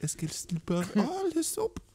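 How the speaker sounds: falsetto mock French; silly French voice. Comic keeps up French soap joke, silly falsetto. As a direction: climb to a squeaky hammed-up French. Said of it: "in that voice".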